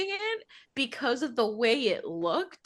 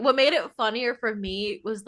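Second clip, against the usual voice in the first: usual speaking voice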